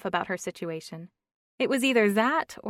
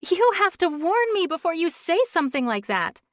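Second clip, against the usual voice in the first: higher and louder, mock scolding; 11 semitones higher, 9 dB louder than usual. A narrator's reading. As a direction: scold her friend half-jokingly. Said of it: "Steph scolded".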